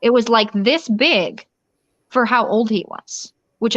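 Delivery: ordinary delivery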